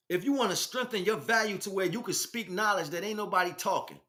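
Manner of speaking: ordinary talk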